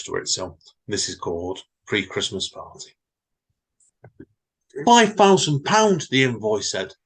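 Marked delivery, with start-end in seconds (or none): 4.8-6.1 slow, loud and high, booming out the figure. A narrator's reading. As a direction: boom it like a boss reading a shock invoice. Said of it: "The managing director's voice boomed out".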